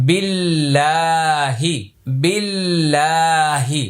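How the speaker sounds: very slow recitation with held vowels; word by word. Shown as a demonstration. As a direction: stretch the single word out for pupils. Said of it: "আমি প্রত্যেকটা আলাদা আলাদা করে বলছি". (আলাদা করে ধীরে টেনে পড়া)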